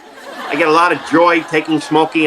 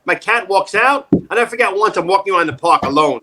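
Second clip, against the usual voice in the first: ordinary talk, nothing marked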